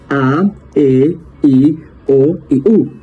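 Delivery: "A, E, I, O y U" sung out long and slow; long vowels. Shown as a demonstration. Teacher to class, vowels drawn out long and slow. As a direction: stretch each vowel of "A, E, I, O y U". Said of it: "suenan más largas".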